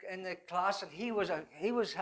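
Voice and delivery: ordinary talk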